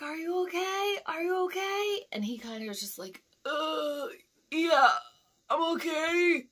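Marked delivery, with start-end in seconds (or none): 0.0-2.0 high-pitched calling out; imitated anxious calling. Driver calls to helper down by the river, anxious calling. Calling down to coworker under bridge. 3.5-6.5 high, loud, pained call back; hammed-up groaning shout from far below; imitated hurt coworker. Thrown helper answers from the river, mock pained call.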